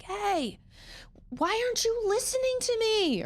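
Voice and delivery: high exasperated mom yell; mock yell. A frustrated mom yelling at her kids, hammed-up. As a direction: shout at the kids who aren't listening.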